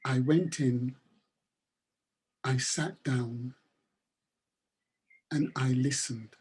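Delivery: calm. Acted. Boy's calm punchline.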